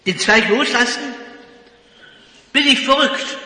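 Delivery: lauter, höherer, entsetzter Aufschrei; ungläubiger Schrei. Acted. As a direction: fassungslos zurückschreien. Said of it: "schreit der Mann". (laut und hoch, entsetzt geschrien)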